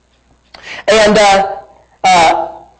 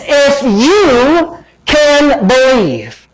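preaching; 6 semitones higher, much slower than usual. Acted. Preaching Jesus' words, slowed.

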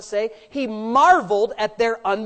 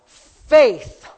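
louder single-word call; shouted punch word; 9 dB louder than usual. Acted. Preacher answering his own question, the word shouted. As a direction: land the answer hard.

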